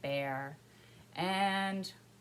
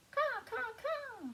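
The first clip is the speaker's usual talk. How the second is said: crow call; slower than usual. A narrator's reading. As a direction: caw like a crow for children.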